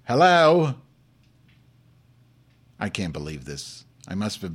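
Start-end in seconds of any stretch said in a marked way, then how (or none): 0.1-0.7 loud, higher-pitched hello; impatient call. Real, not acted. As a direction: call out to listeners who won't answer. Calling to silent chat room listeners.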